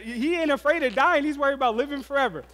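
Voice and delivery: high, loud exasperated amazement; comic disbelief. Retold or imitated. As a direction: exclaim it in mock astonishment. Dad marvels at son's odd worry.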